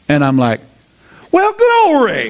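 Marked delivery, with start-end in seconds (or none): 1.3-2.3 high, drawn-out hammed-up whoop; mock jubilation. Pastor reacting to a shared testimony, exaggerated jubilation. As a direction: exclaim it slowly, way up high.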